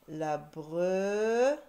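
"brebis" drawn out, loud, broken before "bis"; syllable by syllable. Shown as a demonstration. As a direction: stretch "bre" rising, pause, then "bis". Teacher sounding out syllables.

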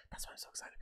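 very soft whispered confession. Real, not acted. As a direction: breathe it out like a secret. Confides why he is excited.

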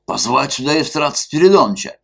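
high, fast, loud summons; calling out loud. A narrator's reading. Zhestyakov shouting for Evstrat Spiridonovich, loud and urgent. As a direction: shout for the police chief across the club. Zhestyakov calls for police chief.

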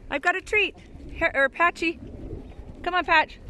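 high, loud call to the animals. Real, not acted. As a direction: holler them over for a treat. Calling the alpacas over.

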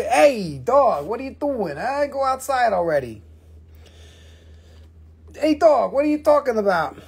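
high-pitched, playful telling-off; high, swooping scold to a pet; scolding the dog. Real, not acted.

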